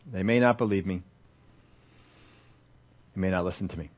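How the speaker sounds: low, soft, hesitant objection; doubtful reply. Acted. Moses answering God's plan, low and doubtful. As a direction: drop the voice into quiet doubt.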